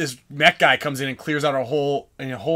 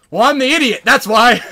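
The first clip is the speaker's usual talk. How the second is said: high, loud comic bluff; reenacted shouted reply; taunting call-back; 9 semitones higher, 11 dB louder than usual. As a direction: holler the excuse back at them. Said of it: "talking shit to 'em".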